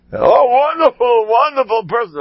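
high, gushing imitation of the rabbi praising someone; raving praise. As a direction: rave about him like an enthusiastic old rabbi. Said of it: "And he was raving".

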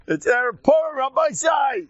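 calling out high and loud; a high, loud Purim call; festive shout. Real, not acted. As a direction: shout it out over the crowd.

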